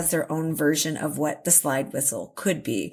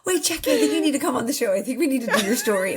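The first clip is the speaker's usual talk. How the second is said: excited laughing; 9 semitones higher than usual. Real, not acted. Delighted laughing reaction.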